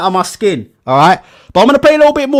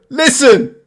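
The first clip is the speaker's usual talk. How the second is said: ordinary talk, nothing marked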